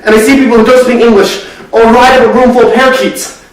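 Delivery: rising into a loud, high outpouring; agitated, mounting outburst; building distress. Acted. Acted monologue builds to an outburst.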